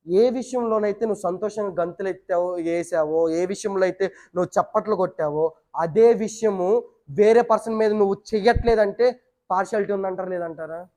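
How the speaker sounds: ordinary talk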